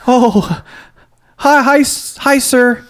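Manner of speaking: very high, timid stammer; nervous mock greeting. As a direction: squeak out a hammed-up frightened hello. Acts out being tied facing Sloth.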